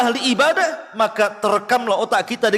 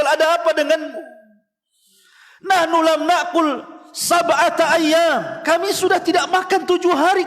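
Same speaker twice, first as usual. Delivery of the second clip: crying wife's plea; 6 semitones higher than usual. Retold or imitated. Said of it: "istrinya teriak sambil menangis-nangis".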